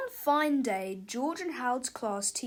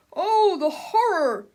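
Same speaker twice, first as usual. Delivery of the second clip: loud, high, drawn-out wail; hammed-up terror; mock horror; 7 semitones higher, 9 dB louder, slower than usual. A narrator's reading. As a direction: lament it melodramatically. Onlooker reacts to villain.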